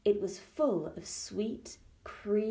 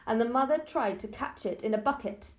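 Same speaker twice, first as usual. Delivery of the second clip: usual speaking voice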